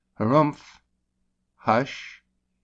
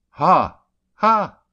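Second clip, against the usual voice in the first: bright high laughing ha ha; joyful ha ha; 8 semitones higher, 7 dB louder than usual. Shown as a demonstration. Teaching joy, a laughing ha ha. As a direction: exclaim it with joy. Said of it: "Joy, as".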